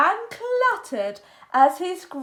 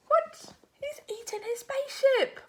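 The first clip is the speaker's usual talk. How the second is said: high, fast shocked cry; aghast as the alien eats the ship; 12 semitones higher than usual. Acted. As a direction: blurt it in disbelief.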